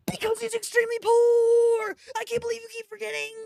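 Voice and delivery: high loud rant, rushed at the end; mock outraged shout. Real, not acted. Reader yells at the reader about Vance being poor. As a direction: yell it in exasperation.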